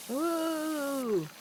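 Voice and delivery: delighted intake of breath; excited gasp. Real, not acted. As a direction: gasp then a high ooh. Reacts to Barnes and Noble.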